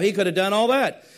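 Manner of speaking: loud, quick preaching punch; emphatic. Real, not acted. Preacher stirring the congregation, loud and fast. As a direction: hammer the line home. Preacher listing what God could do.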